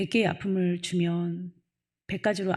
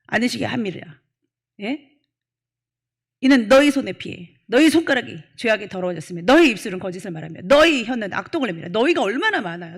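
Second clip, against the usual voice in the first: louder, higher sermon delivery; full-force preaching; 5 semitones higher, 8 dB louder than usual. Acted. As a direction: preach it hard to the congregation. Preaching to congregation.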